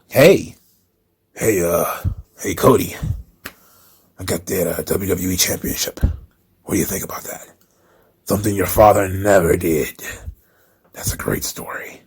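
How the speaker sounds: put-on low, soft sly old boss voice, almost whispered; lightly voiced scheming Vince, hushed and low; Vince impression. Imitating Vince manipulating wrestlers.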